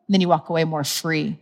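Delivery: normal talking voice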